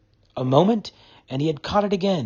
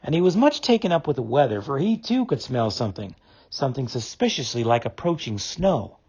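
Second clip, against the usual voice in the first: usual speaking voice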